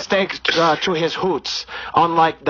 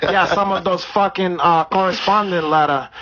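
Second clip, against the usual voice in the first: put-on Brazilian accent; 3 semitones higher than usual. Comedian holding a put-on Brazilian accent.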